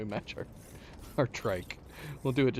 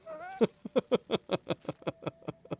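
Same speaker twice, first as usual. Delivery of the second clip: high-pitched burst of laughter; high giggle; 13 semitones higher than usual. Real, not acted. Amused by huge cargo load.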